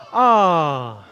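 put-on aww for the baby. Shown as a demonstration. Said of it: "we say".

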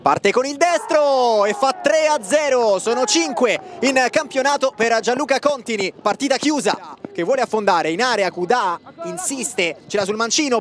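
excited play-by-play, shouting the goals; breathless italian goal commentary. Acted. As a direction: call the match live, building to yells. Live match commentary.

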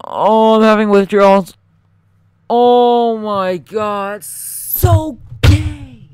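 high, loud, slow groaning; loud dismay. Real, not acted. Gamer loudly moaning over the lost run. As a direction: moan it out loudly.

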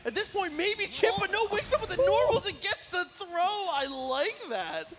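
loud, high hype call building then stretching out at the end; excited play-by-play. Real, not acted. Caster calling a throw on wakeup, excited play-by-play. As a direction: call the clutch moment at full voice. Caster calls a last-second comeback.

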